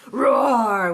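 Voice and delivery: loud, drawn-out roar; big storybook roar; roaring engine word. A narrator's reading. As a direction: stretch the word like an engine revving. Tractor noise acted in story.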